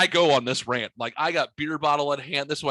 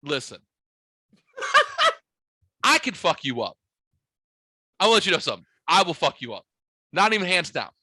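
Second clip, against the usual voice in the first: retold threat; 5 semitones higher, 4 dB louder than usual. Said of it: "I'm like".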